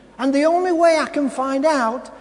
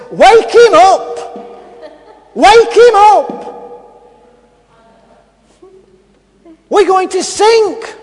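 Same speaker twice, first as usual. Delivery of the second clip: loud, high, desperate cry to wake him; frantic shout; 8 semitones higher, 9 dB louder, slower than usual. Acted. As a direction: yell in panic as the boat sinks. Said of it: "disciples shouts and says".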